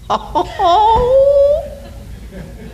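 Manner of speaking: long, high, drawn-out "oh" of relief; high wailing whoop of joy; elated cry. Retold or imitated. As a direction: let out an overjoyed, tearful-sounding cry. Reenacts sighting the finish line.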